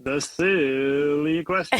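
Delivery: slow, drawn-out segment announcement; mock announcer. Co-host announcing the silly questions segment, mock announcer. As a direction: stretch it out like a silly segment title. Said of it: "what are they, [name]?".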